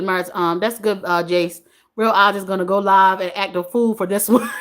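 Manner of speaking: ordinary talk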